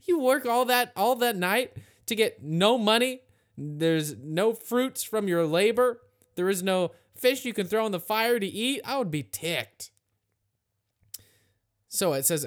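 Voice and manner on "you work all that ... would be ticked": higher, emphatic griping; mock exasperation. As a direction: play at being ticked off over wasted work. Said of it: "Man, I would just be pissed".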